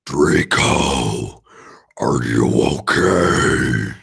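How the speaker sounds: very deep, slow gothic drone; gloomy goth deadpan. Acted. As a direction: drag it out low and mournful. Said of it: "I asked in a gothic voice".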